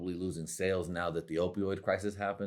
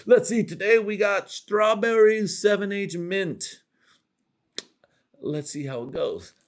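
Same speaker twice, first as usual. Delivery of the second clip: mock product reviewer; 11 semitones higher, 5 dB louder than usual. Reviewers rating the drug like an energy drink, mock chirpy. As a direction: play a bubbly online taste-tester. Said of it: "reviewing this shit like".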